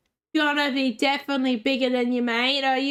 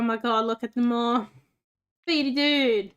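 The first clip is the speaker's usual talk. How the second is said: normal talking voice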